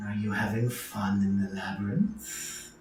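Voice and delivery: slow, hushed magical whisper; whispered message. Acted. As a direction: breathe it like a secret message. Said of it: "the whisper is going to end up being".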